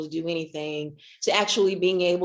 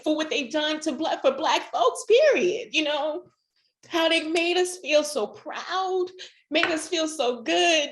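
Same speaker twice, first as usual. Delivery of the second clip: fighting back tears as the voice rises; tearful; 9 semitones higher than usual. Real, not acted. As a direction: voice climbing much higher, near tears. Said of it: "I've been crying so much".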